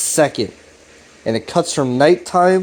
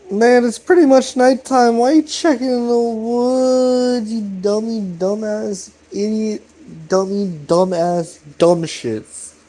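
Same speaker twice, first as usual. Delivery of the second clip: mocking friend voice; 7 semitones higher, slower than usual.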